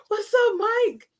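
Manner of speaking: high, drawn-out casual hello; familiar greeting. Pretend. Fan greeting Michael like a homie, chummy and drawn out. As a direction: greet him like an old homie. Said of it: "Mike's the homie".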